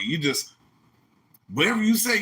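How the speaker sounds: ordinary talk, nothing marked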